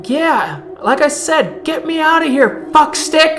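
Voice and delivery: high, loud shouting at the captors; angry yell. Acted. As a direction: bark insults from behind bars. Veronica yelling from the cell.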